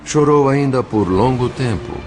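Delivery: ordinary talk, nothing marked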